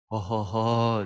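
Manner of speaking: imitating the pastor's easy ho-ho laugh; lightly voiced, cheerful chuckle; mock jolly laugh. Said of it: "se ríe".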